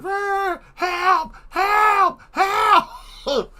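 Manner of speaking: very high screamed cries for help; high-pitched mock alarm screams; imitated alarm scream. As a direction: shriek like a screaming alarm, each cry drawn out. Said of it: "it screams".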